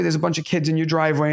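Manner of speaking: ordinary talk, nothing marked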